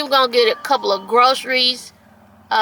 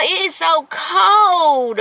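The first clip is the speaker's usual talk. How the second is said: slow, higher drawn-out complaint; slow higher whine about the cold; 6 semitones higher, much slower than usual. Real, not acted. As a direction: stretch out how cold it is.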